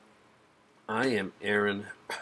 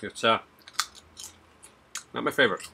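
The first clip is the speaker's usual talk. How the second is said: crunching between words; talking while chewing chips; 3 semitones higher than usual. Real, not acted. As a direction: mouth partly full of chip. Seen 0: Chip repeatedly raised to lips; he chews while speaking.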